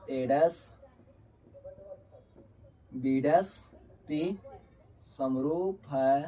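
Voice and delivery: low-key, word-by-word dictation; spaced-out words with long pauses; slow dictation. Real, not acted. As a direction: dictate slowly while writing it out. Dictates while writing.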